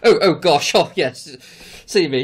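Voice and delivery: loud, hammed-up British royal; talking like a royal. Podcaster mocking his own English accent in the States, hammed-up royal. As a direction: put on an over-polite royal voice. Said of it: "like, talking like a royal".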